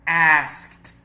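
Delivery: "Asked" ending in a crisp T sound. Shown as a demonstration. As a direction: say "Asked" loudly as askt. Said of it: "we pronounce E D as a T".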